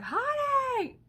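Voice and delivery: high calling yell, stretched out; yelling for husband. Retold or imitated. Wife stuck in the tub calls her husband, a long high yell. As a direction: call him from the next room. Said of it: "I yelled for my husband".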